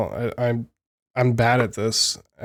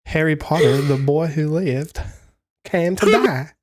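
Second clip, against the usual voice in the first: drawn-out mock American accent, British creeping in; botched American accent; 2 semitones higher than usual. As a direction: attempt American, let British slip in. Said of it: "a fucking British accent in there for some reason".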